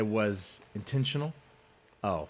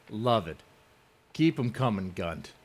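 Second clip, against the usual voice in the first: usual speaking voice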